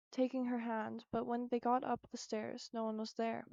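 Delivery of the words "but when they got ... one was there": ordinary delivery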